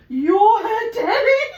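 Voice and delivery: high, loud, drawn-out pronouncement; acted insistence. Insisting to the neighbour he owns the cat, loud and emphatic. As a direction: hammer it home slowly at full voice.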